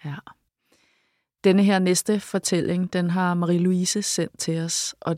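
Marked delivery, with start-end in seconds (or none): none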